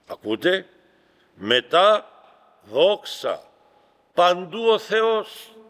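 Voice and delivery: fervent preaching. Acted. Priest proclaiming the nun's prophecy to the congregation, fervent preaching.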